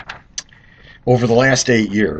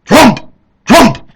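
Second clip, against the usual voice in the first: higher, louder barked word; dog barking Trump; 11 semitones higher, 8 dB louder, much slower than usual. Retold or imitated. Dog answering its owner, barked in a higher, louder voice. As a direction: bark it like a dog. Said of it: "the dog might go".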